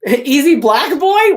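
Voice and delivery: incredulous repeat. Real, not acted. Reader repeating the script line in stunned disbelief. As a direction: savor the absurd line with amusement.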